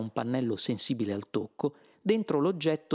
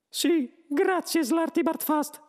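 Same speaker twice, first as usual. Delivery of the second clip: high, loud squeaky interruption; brusque mouse; 4 dB louder, slower than usual. A narrator's reading. Mouse cuts off the old man, brusque squeak. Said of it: "intervenne il topo Benji bruscamente".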